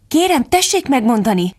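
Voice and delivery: slightly hesitant little request; a little shy. A narrator's reading. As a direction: ask politely with a touch of shyness. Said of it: "érdeklődött Alice egy kicsit félénken".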